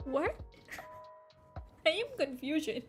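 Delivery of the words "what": ordinary delivery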